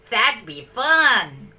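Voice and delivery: slow, drawn-out happy pup; small puppy agreeing gladly; puppy delight. A narrator's reading. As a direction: savor the fun idea. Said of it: "cried Patch".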